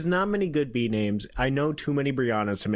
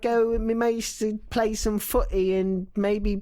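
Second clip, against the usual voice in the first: mock British accent; 5 semitones higher than usual. Trading fake British accents.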